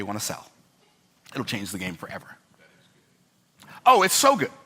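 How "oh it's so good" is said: bursting with delight, pitched up. Real, not acted. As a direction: savour how good it is. Delighted exclamation about his idea.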